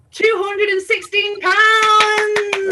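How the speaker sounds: slow, high, loud reveal; excited announcement. Real, not acted. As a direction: announce the total with glee. Announcing the charity total.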